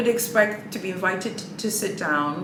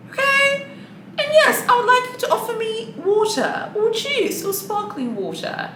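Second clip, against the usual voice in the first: emphatic insistence; 13 semitones higher than usual. Real, not acted. Emphatic demand, high pitch.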